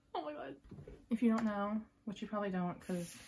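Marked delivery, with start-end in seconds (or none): 0.0-0.6 half laugh, half sob; laughing through tears; laugh-crying. Real, not acted.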